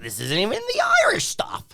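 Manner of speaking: high, quick put-on Irish accent; hammy Irish leprechaun accent. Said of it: "My Irish accent, has it gotten better?".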